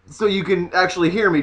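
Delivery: normal talking voice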